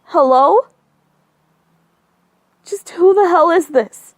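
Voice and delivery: high, loud furious demand; shrill-pitched angry outburst; angry shouting. Acted. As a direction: yell into the phone, enraged. Woman furious on the phone.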